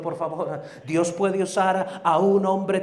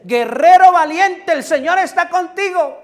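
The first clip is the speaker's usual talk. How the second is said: proclaimed shout; 13 semitones higher, 14 dB louder than usual. Acted. Preacher voicing God's call to Gideon, booming shout. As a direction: thunder the title out like heaven speaking.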